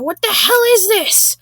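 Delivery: high-pitched exasperated outburst; hammed-up disbelief, pitch jumping up; outraged Karen. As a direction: demand it in mock outrage. Acted Karen outraged at language switch.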